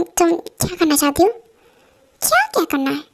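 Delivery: ordinary delivery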